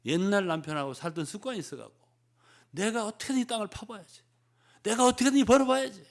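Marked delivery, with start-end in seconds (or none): 2.7-6.1 higher, loud, gritting through it; a higher, much louder grim vow to earn it alone; straining resolve. Acted. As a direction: push like you'll dig the ground yourself. Voicing the old striving habit.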